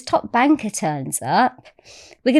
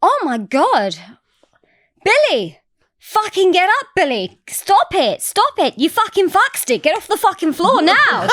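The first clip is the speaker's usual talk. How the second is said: high, exasperated mock outburst; hammed-up, high-pitched telling-off; imitated flipping out; 9 semitones higher, 3 dB louder than usual. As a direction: order him about like an appalled woman losing it. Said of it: "like, flipped out and she was like".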